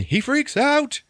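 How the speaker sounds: high, loud, drawn-out squeal; mock freak-out. Telling how he freaks out, high and loud. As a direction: squeal it out in pretend panic.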